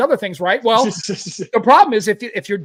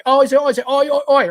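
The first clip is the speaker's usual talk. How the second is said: higher, louder hammed-up Aussie accent chant; mock Aussie bloke; 8 semitones higher, 5 dB louder than usual. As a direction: play a cartoon Aussie shouting oi oi oi. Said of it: "some Aussie guy".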